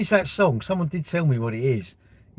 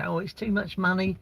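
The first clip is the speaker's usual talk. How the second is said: higher, softer mocking whinge; mock whinging; 6 semitones higher, 5 dB quieter than usual. LinkedIn users moaning about holiday prices, mock whinging. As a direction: imitate moaners with a lightly whiny lift. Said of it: "they're all whinging about".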